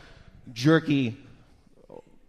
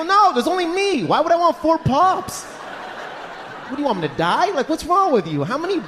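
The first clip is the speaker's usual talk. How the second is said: exasperated laughing; 6 semitones higher than usual. Retold or imitated. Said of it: "I go".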